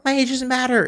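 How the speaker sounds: high-pitched mock old lady; indignant older woman. Heckler asked her age, high mock indignation. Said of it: "and she's like".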